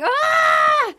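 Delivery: very high shrill mock scream; frustrated scream. Woman at her limit, high hammed-up scream. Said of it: "she was making a lot of noises".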